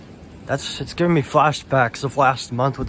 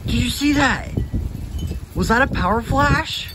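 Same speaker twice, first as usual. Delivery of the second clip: excited; 10 semitones higher than usual. Real, not acted. Startled by a flash.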